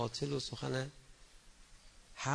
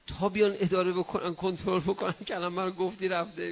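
pitched up and louder, urging; rising fervour; 5 semitones higher, 7 dB louder than usual. Real, not acted. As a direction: lift the voice with preaching zeal.

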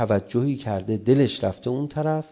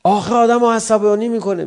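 high, loud mock complaint; exasperated protest; 9 semitones higher, 8 dB louder than usual. A parent objecting to the preacher, exasperated. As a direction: protest in an aggrieved, raised voice.

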